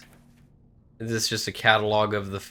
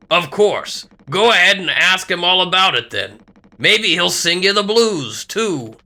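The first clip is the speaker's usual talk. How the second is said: higher, louder sarcastic retort; irritated game character; 7 semitones higher, 8 dB louder than usual. Acted. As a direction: send them off with loud sarcasm.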